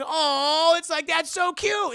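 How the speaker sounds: high, loud "aww" of delight; gushing. Retold or imitated. Reacting to the son's trophy inscription, gushing squeal. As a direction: melt over something adorable. Gushes over the sweet inscription.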